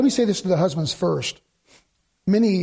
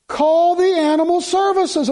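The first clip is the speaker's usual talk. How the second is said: high, slow mock-sentimental plea; mocking bleeding heart; 11 semitones higher, 5 dB louder, slower than usual. Preacher mimicking tender-hearted callers, mock plea. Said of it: "there'd be someone say".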